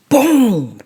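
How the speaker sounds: ordinary delivery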